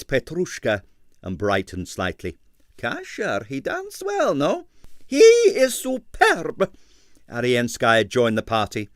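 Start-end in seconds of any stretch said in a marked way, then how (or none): 2.8-4.7 hopeful impresario. A narrator's reading. Stroganoff hopeful question. 5.1-6.7 high, loud and slow proclamation; grand ballerina. A narrator's reading. Arienskaya's grand praise.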